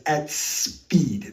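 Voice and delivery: AT SPEED set apart with a drawn-out hissing S; "at speed" as a separate, pointed phrase; emphasized. Real, not acted. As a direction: lean hard into SPEED, stretching its first sound. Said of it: "I need to emphasize".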